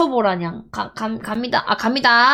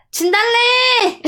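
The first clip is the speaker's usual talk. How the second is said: toast call out; 9 semitones higher, slower than usual. Acted. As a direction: call it out to the room. Calling out a toast.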